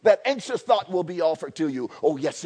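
usual speaking voice

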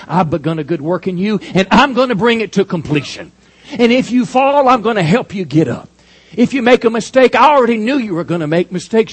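loud, high promise from Jesus; preaching as Jesus. Acted. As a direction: proclaim it as Jesus speaking. Voicing Jesus, preaching loudly.

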